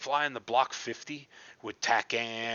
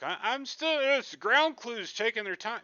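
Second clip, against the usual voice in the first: nervous excuse; 12 semitones higher, 4 dB louder than usual. Retold or imitated. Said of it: "And I'm like".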